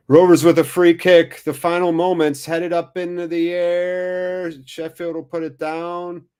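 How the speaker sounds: high, loud commentary on the last free kick; excited play-by-play. Real, not acted. Fan commentating the last-minute free kick, high and loud. Live play-by-play at final whistle.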